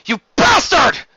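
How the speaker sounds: slow, high, loud furious shout; enraged prisoner screaming. Acted. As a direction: hurl the insult. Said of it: "Sheridan shouted".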